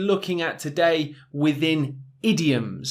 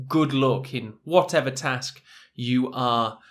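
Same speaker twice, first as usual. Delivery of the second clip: usual speaking voice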